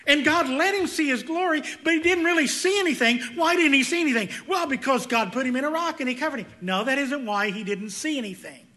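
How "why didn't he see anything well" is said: normal talking voice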